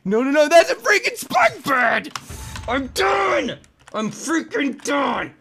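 high, loud angry outburst; frustrated shout. Real, not acted. Raging at the game.

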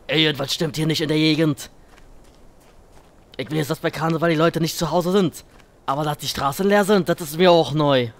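loud, badly faked Kölsch; bad Kölsch accent. Player joking about empty streets at carnival, bad Kölsch accent. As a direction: put on a clumsy Cologne dialect. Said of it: "mein Kölsch ist sehr schlecht".

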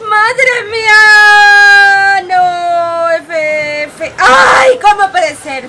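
loud high wail, stretched out; shocked scream. Real, not acted. Streamer shocked by Fabi's play, loud high wail. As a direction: scream in disbelief at the screen.

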